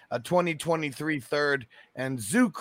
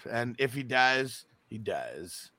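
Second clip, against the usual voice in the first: Ivan Drago; 5 semitones lower, 8 dB quieter, slower than usual. Retold or imitated. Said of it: "Ivan Drago".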